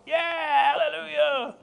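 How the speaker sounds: high, whooping crowd cheer; mock congregational hallelujah. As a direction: imitate a whole church hollering praise. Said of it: "And everyone went".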